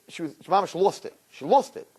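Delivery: usual speaking voice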